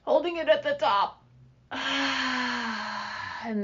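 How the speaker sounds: slow exhale on a sigh; breathing demo. Shown as a demonstration. Coach showing skaters how to breathe, slow sighing exhale. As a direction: show the breath, let it all out slowly. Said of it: "some big breaths in through the nose".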